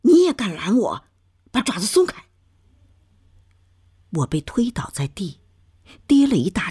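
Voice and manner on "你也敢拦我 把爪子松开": high, forceful scolding outburst; angry shout. A narrator's reading. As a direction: lash out at the child, pitch raised. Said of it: "喝道".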